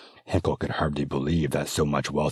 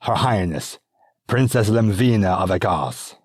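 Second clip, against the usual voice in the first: louder, ringing herald's announcement; formal announcement; 5 semitones higher, 7 dB louder than usual. Acted. Servant announcing the princess at the doors, loud and formal. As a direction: announce her entrance to the room. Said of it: "she announced".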